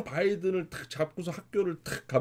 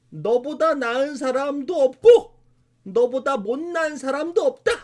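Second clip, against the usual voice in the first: firm mother; 7 semitones higher, 4 dB louder, slower than usual. Retold or imitated. Mother firmly instructs her son.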